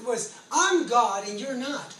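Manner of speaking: ordinary talk, nothing marked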